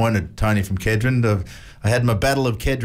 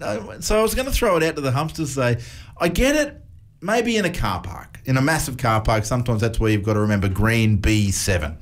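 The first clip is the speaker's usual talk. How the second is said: ordinary delivery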